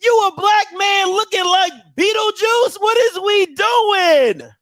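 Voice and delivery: high, stretched-out incredulous scolding; mock outrage. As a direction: roast him in comic disbelief. Mock outrage at Hugh's look.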